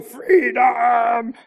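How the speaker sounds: a drawn-out mock battle cry of "Freedom"; Braveheart freedom cry. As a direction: give a hammed-up Braveheart "Freedom". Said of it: "that scene where he rides along".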